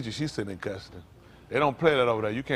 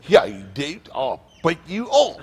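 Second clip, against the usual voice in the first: louder, higher Australian caricature; mock Australian accent; 5 semitones higher, 5 dB louder, slower than usual. Comic imitates properly-spoken gang members from down under, mock Australian accent. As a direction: put on a hammed-up Down Under accent. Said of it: "Talking from the down under somewhere".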